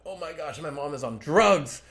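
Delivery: hammed-up panic about mom; mock alarm. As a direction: blurt it out in shocked disbelief. Realizing his mom takes psychedelics.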